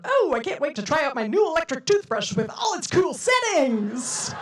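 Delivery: hammed-up cover story yelled to roommates; yelling out. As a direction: belt it through the wall so the roommates hear. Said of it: "make sure to yell out".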